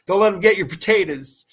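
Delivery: laughing through the words. Real, not acted. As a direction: chuckle while you say it. Laughing cheer to a viewer.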